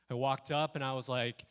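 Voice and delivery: ordinary delivery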